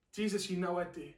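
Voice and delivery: hushed Peter answering; soft humble answer. Retold or imitated. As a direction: answer gently, almost under breath. Peter answers Jesus again.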